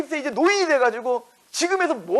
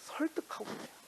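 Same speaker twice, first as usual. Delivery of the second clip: whisper; 6 semitones higher, 17 dB quieter than usual. Acted. Preacher drops to whisper.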